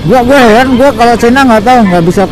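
usual speaking voice

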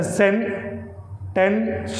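Sentence in three separate sounds, sen, ten, s; split into syllables. Shown as a demonstration. Teacher demonstrating three tongue stops, sentence broken sen-ten-s. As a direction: pause after each part of sentence. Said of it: "टंग है जो तीन जगह रुकेगी".

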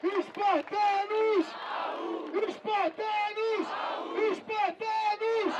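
high drawn-out shout to the crowd; rally the crowd, stretching each cry; crowd rallying call. Real, not acted. MC calls out to the crowd. (grito de guerra agudo e arrastado)

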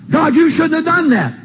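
high, protesting reproach; acted complaint to God. Believer in a tug of war with God, high acted reproach. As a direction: protest upward in a high, pushed voice.

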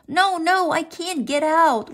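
high, insistent refusal; protesting child. A narrator's reading. Froggy refusing mother's order to leave, high protest.

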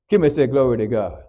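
usual speaking voice

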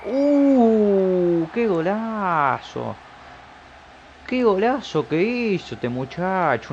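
high, slow, drawn-out amazed shout; stunned goal cry. Real, not acted. Gamer marveling at his player's goal, slow excited cry. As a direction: stretch the words out like a TV commentator.

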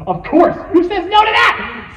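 loud, high excited outburst; high-pitched shout of obvious agreement; eager kid reply. Acted. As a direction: blurt it out like a thrilled ten-year-old. Acting his excited ten-year-old self.

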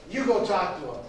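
lightly voiced husband sending his wife; gruff husband's order. Husband sends wife to greet the visitor, curt order.

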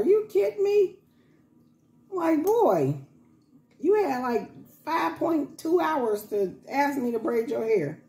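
high incredulous are you kidding; mock outrage at the late ask; exasperated. Real, not acted. Exasperated rant about son.

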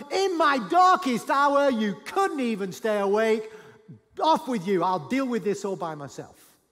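loud, high shouted reproach easing slightly; angry rebuke. Acted. Imagined Jesus dismissing sleeping disciples, angry shouted rebuke. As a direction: shout them away in hurt outrage.

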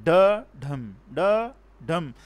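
"Da, dham" in separate beats, dham the stressed one; "da" then weighted "dham", a slow iambic pulse; heartbeat rhythm. Shown as a demonstration. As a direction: beat out "Da, dham" evenly like a heartbeat. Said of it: "It works like heartbeat".

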